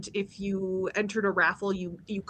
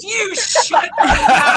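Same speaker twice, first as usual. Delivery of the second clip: laughing accusation; 10 semitones higher, 11 dB louder, slower than usual. Retold or imitated. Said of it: "And he goes".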